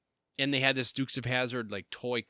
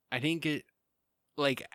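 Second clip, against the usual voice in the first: normal talking voice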